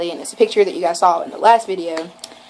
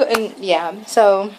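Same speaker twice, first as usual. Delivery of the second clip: ordinary talk, nothing marked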